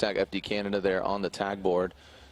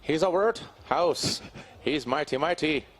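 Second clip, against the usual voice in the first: high, slow hammed-up Irish accent sing-song; 5 semitones higher than usual. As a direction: croon the jingle in a bad Irish lilt. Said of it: "can you do that in a, a, an Irish accent?".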